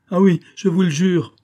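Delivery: high, loud, urgent promise; impassioned vow; fervent oath. A narrator's reading. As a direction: swear it desperately. Desperate oath in pleading.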